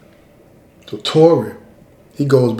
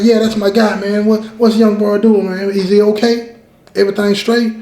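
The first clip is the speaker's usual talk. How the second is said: excited; 5 semitones higher than usual. Retold or imitated. Worried self quote about friend.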